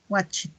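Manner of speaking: "watched" ending in a voiceless t; final T. Shown as a demonstration. Teacher drills past forms, "watched" ending in a clear T. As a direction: add a released T to close "watched". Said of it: "Al final suena como una T".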